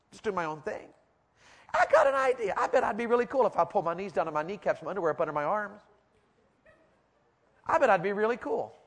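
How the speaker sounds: hammed-up dopey teen musing; mocking Shay's thoughts. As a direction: send up a kid planning to look cool. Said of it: "here's what he thought".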